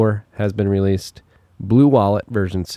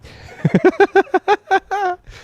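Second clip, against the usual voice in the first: laughter; 5 dB louder than usual. Real, not acted. Cohost jokes about his connection.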